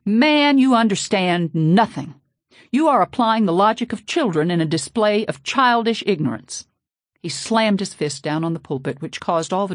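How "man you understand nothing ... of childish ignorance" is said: loud, high furious outburst; blowing up. A narrator's reading. Preacher blowing up at the chief, furious outburst. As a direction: explode at him in rage. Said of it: "Father blew up".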